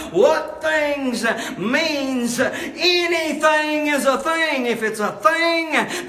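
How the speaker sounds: loud, high preacher's punch on anything; rising preacher shout; preaching emphasis. Real, not acted. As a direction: hammer the point home. Preacher emphasizing scripture words.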